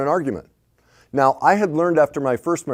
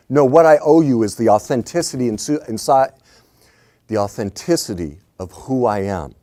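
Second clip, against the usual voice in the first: ordinary talk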